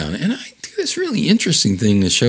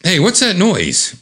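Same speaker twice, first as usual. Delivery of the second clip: alarmed; 10 semitones higher than usual. Retold or imitated. Just before the crash.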